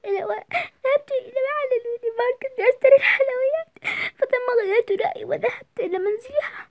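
high, crying excuses; sobbing through her explanation; tearful daughter. Acted. Daughter crying as she confesses to her father.

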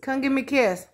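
a touch of pet-talk coaxing. Acted. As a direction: coax the dog over sweetly. Asking her dog for kisses.

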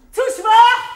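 loud. Acted. Director demands more drama.

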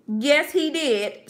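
loud, slow sing-song tattle; tattling sister. Acted. Sister telling on her little brother, loud and slow. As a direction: drag it out like a telltale sister.

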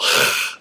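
voiceless throat scrape; guttural kh sound. Shown as a demonstration. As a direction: demonstrate a drawn-out Hebrew kh on its own. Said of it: "includes a guttural".

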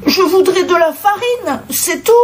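high-pitched plea of innocence; protesting. A narrator's reading. Tied-up wolf asking the goat for flour, protesting. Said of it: "protesta Lou".

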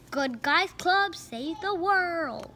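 slow, grand announcement; dramatic title read. Acted. Narrator announcing the book to a child.

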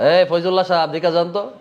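high, casual call by first name; disrespectful name-calling. Shown as a demonstration. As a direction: hail the teacher rudely like a peer. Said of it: "হইব?". (নাম ধরে বেয়াদবি করে ডাকা)

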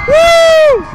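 high, loud thrilled cheer; excited whoop. Real, not acted. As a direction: whoop with real joy. Cheering on the ride.

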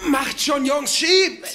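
high urgent shout. Real, not acted. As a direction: yell at them to push. Pushing a heavy machine upstairs.

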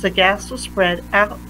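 plain reading voice

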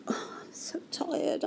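breathy whispered sigh, slow; imitating a worn-out doctor, slow and whispered; exhausted whisper. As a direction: whisper it like a doctor at the end of a long shift. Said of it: "She sat there going".

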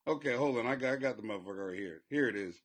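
normal talking voice

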